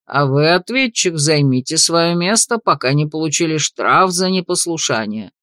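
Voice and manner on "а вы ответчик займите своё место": usual speaking voice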